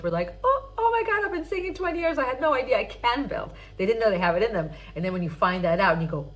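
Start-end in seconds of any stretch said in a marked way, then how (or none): 0.4-3.5 high-pitched excited discovery, pitch easing down; amazed student. Acted. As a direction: act out a student's astonished realization. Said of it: "my students discover different parts".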